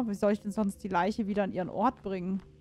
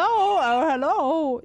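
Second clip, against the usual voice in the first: loud startled hello; 6 semitones higher, 8 dB louder, much slower than usual. Real, not acted. Answering phone call in game.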